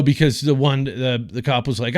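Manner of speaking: ordinary delivery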